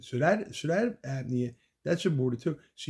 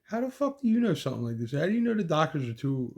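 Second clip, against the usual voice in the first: retold quarrel with fiancée, angry raised voice; angry disbelief; 5 semitones higher than usual.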